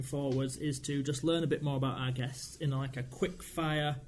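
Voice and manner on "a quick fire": ordinary talk, nothing marked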